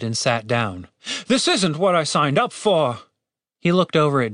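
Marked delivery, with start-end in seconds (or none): 1.3-3.0 higher-pitched, exasperated grumble; disgruntled guard leader; fed-up complaint. A narrator's reading. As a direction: complain like a man sick of his job.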